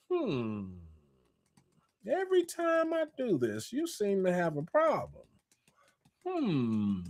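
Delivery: soft, knowing skeptical hum around the line; suspicious hmm. Pretend. Sizing up a fake friend, soft suspicious murmur. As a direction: quietly call out the pattern.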